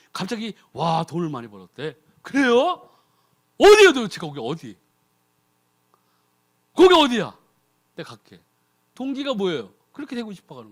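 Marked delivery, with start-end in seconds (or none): 2.2-8.4 louder, greedy eagerness; eager asking. Retold or imitated. Believer hears of a miracle meeting, loud and eager. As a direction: demand where it is.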